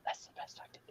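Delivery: whispered, no voice; whispered aside. Shown as a demonstration. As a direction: breathe it out as a secret.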